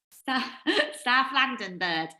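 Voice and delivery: badly faked South London accent. Host reads out Geordie's channel name, bad London accent. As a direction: have a laughing go at a London accent. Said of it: "Terrible accents. I'm so bad at accents".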